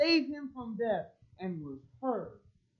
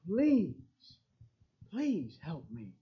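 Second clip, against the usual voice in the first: begging; 3 semitones lower, 4 dB quieter, slower than usual. Shown as a demonstration. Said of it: "a begging".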